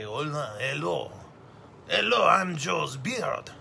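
slow, loud, put-on silly French voice; a hammed-up French beard voice; imitating a French braid. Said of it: "That's my imitation of a French braid".

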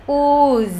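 "Oos", just the long U and final buzz. Shown as a demonstration. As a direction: isolate the long vowel as "Oos". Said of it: "U dài này".

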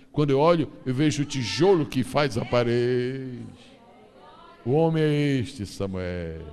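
loud, solemn voice of God; God speaking to Samuel. Acted. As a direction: proclaim each line as God instructing.

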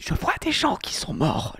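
slow, hushed eerie whisper of a dead-seeing cat; a slow, eerie mock-ghost line; creepy ghost voice. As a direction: speak slowly like a spooky ghost child.

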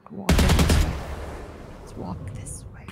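whispering. Real, not acted. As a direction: whisper so enemies can't hear. Sneaking toward site.

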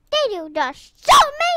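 high, loud indignant challenge; rising, affronted shout; outraged question. Real, not acted. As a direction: demand it loudly, punching SHOOT. Repeats, outraged at being shot.

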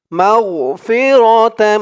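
মাগফিরাতাম with a humming তাম; nasal tam. Shown as a demonstration. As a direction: hold the nasal ending of মাগফিরাতাম. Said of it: "তাম বলে গুণনা করতে হবে".

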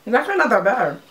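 biting snack mid-sentence; talking while chewing. Real, not acted. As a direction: mouth partly full. Seen 1: Middle speaker holds stick, bites it mid-sentence while talking.

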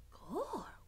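soft, hushed exclamation; whispering. A narrator's reading. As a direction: breathe it out in awe. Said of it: "whispered Mig".

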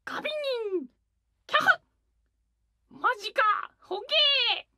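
high, cartoonish sound for each face; chibi faces. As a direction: voice each little expression. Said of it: "そう、それ！". (表情ごとの可愛い声)

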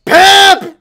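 high, loud frantic yelling at the screen. Real, not acted. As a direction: shout at the game in panic. Gamer yelling at game character.

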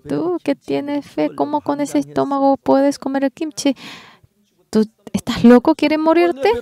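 high, incredulous wife scolding her husband; a wife appalled he eats kimchi with a sick stomach. Retold or imitated. Wife scolds him at meal.

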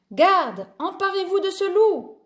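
high, ringing royal command; storybook king's yell; jealous king yelling. A narrator's reading. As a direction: call the guard in outrage. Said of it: "hurla le roi jaloux".

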